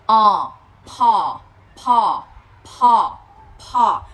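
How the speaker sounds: "po" as English paw, puffed p, long falling ah; long open vowel. Shown as a demonstration. Teacher blends p and vowel, "po" long and open. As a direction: pop the p, then draw out the open "o". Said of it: "tất cả đều là âm A hai chấm hết".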